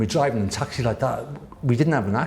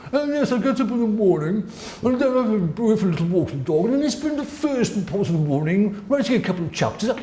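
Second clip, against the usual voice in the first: higher, mocking voice of a leisurely middle-class author; mock middle-class writer; 8 semitones higher than usual. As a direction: send up the comfortable writer's morning, pitched up.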